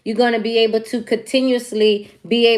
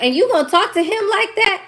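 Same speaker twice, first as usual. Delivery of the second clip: shocked friends; 10 semitones higher than usual. Retold or imitated. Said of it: "they was all like".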